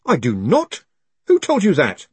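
loud, rising outrage; indignant denial. A narrator's reading. Moist denies killing and demands the source, indignant. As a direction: protest it hotly.

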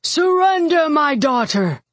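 high, booming command that falls away; ringing call to surrender; rolling battlefield voice. A narrator's reading. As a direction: proclaim it across a battlefield. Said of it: "His voice rolled through the battlefield".

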